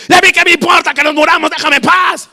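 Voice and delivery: high, loud, fast retort; hammed-up irritated yelling; irritated woken sleeper. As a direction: snap back, annoyed at being woken. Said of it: "yo hubiera despertado y".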